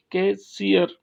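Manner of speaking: Cashier split as कै, shear; cashier in two separated parts, weight on shear; stress on last syllable. Shown as a demonstration. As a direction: set off shear at the end of cashier. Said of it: "shear पे stress लगाएंगे".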